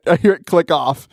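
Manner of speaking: normal talking voice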